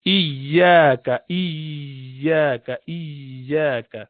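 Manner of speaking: iyaka repeated with a held, doubled ya; slow model recitation for the student; tajweed demo. Shown as a demonstration. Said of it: "So you say".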